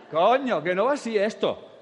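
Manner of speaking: high, loud outburst; loud exclamation. Real, not acted. Speaker corrects the woman, loud and high. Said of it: "speaker exclaims to audience".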